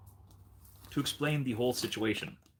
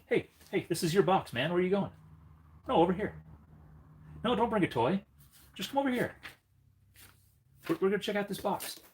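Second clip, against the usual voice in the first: high sing-song coaxing voice for the dog; pet talk; 8 semitones higher than usual. Acted. Owner coaxing his dog to the gift box, high sing-song. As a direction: call the dog over sweetly, way up high.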